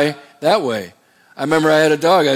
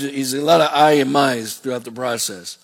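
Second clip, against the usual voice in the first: ordinary talk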